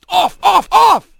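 high, urgent repeated shouts; shouting. A narrator's reading. Sergeant ordering Rat off his back, shouting. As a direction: shout a frantic order, building in force. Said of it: "he heard Hawk shout".

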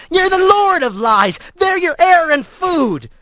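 high, raw, accusing cry; furious accusation. Acted. Tayo clawing the trickster, furiously accusing. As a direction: hurl it at him while clawing.

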